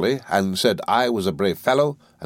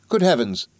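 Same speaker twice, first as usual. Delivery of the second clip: a higher, mildly upset cry of alarm; cried out; 6 semitones higher than usual. A narrator's reading. Mother cries out at Peggotty, mildly alarmed. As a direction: gasp it out as a lightly voiced mother. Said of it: "cried my mother".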